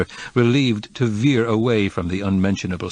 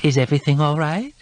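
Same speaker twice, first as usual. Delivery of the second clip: higher, worried question to a guest; anxious check at his elbow, higher; 7 semitones higher than usual. A narrator's reading. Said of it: "asked an anxious voice".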